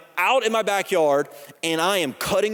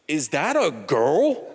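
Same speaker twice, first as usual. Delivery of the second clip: put-on slow, shaken "Is that a girl?"; a dazed father at the ultrasound; stunned question; 3 semitones lower, slower than usual. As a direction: ask it slowly, in shock.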